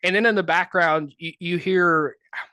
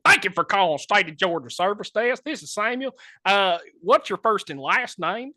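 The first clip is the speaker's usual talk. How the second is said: put-on country accent; 5 semitones higher than usual. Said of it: "be as country as you possibly can".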